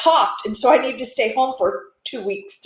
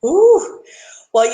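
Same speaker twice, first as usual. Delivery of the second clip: normal talking voice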